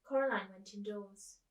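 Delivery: ordinary delivery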